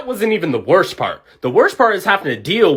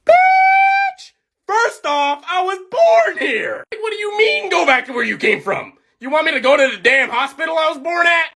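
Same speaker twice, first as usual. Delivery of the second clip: shouted much higher, near tears of fury; outraged rant; 12 semitones higher than usual. Real, not acted. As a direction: blast him, you were born here. Outraged reply to racist remark.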